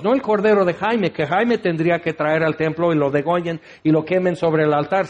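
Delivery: ordinary talk, nothing marked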